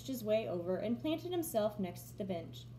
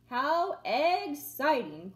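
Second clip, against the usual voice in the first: slow, louder rooster crow of delight; crowing with excitement; 3 semitones higher, 7 dB louder, slower than usual. A narrator's reading. Rooster thrilled about the secret mission, crowing slowly. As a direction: stretch it out like a proud crow. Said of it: "crowed Rooster".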